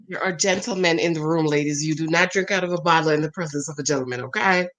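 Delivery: low, measured mock reprimand; mock-prim scolding. Host teasing her co-host about drinking from a bottle, mock-prim scolding.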